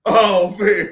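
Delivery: airy laugh into a loud oh man; real laughter. Real, not acted. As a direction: crack up and let it out. Laughing at own joke.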